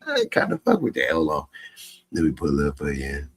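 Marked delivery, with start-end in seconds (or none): 0.1-1.1 hyped approval. Retold or imitated. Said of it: "y'all gonna be like".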